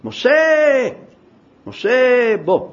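loud shout, high and strong; God calling out. Acted. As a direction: call Moses back, full voice. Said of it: "וצועק".

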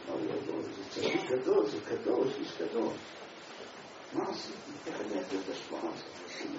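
soft, breathy lament of despair; despairing whisper. Retold or imitated. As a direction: whisper it broken and lost. Man weeps alone in shul. (קינה בלחש, שבור ומיואש)

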